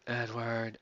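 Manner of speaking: faint, husky whispered question; barely able to speak. Acted. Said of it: "husky sound of my own voice".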